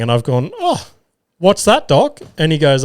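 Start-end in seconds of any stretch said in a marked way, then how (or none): 0.6-2.2 high, mock-surprised question to the doctor; mock innocent surprise. Patient feigning surprise at the doctor's news, mock innocent. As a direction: play dumb, eyebrows up, innocent.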